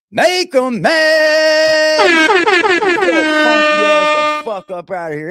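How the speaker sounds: high, slow put-on shout; exaggerated troll caricature; mock troll voice. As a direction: ham up the troll's taunt.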